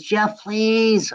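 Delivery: a hint of a nineteen-year-old begging Jeff; pleading young woman. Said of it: "She says".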